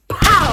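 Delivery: loud, high "pow"; punch sound. Acted. Reader cheering Donatella's comeback, loud punch noise. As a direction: fire it off like a landed blow. Cheering each verbal hit.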